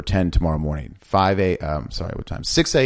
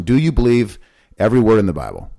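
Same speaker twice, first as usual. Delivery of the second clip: normal talking voice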